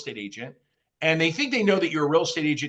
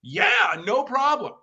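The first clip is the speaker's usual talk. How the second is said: a much higher, eager client voice; eager homeowner; 9 semitones higher, much slower than usual. Retold or imitated. Happy homeowner agreeing to the agent's visit, high and eager. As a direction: play the happy client saying yes.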